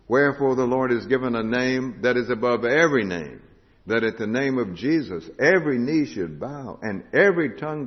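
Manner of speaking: preacher proclaiming the resurrection in full voice; full-force preaching. Acted. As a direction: proclaim it to the whole room.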